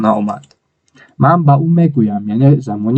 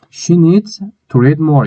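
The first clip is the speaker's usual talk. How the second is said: very slow, raised model reading; slow model sentence; 4 semitones higher, much slower than usual. Shown as a demonstration. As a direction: model it slowly for students to repeat. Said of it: "teacher models sentence".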